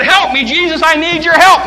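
a mother begging Jesus loudly for her daughter; desperate pleading cry. Acted. Mother begs again, louder.

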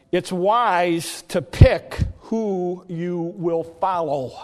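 measured, drawn-out pronouncement; slow, emphatic moral. Retold or imitated. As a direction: deliver it very slowly, word by word.

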